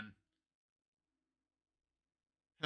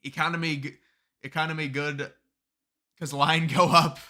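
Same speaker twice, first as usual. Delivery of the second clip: mock caveman voice; 5 dB louder than usual. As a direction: say it like a caveman proud of his logic. Mocking a dumb stock market argument.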